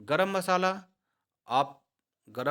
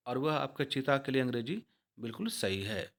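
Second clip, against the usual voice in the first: ordinary talk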